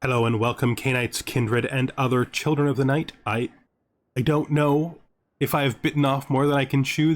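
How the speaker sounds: ordinary talk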